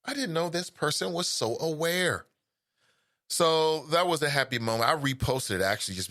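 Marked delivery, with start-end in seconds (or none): 0.0-2.2 higher mock double take; stunned surprise. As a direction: caught off guard by his own child. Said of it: "you just gotta look back like".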